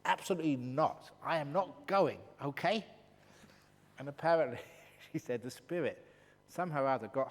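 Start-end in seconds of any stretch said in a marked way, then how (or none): 0.1-2.8 flat no, shouted back at him; loud stubborn refusal. Retold or imitated. Woman arguing with the spirit.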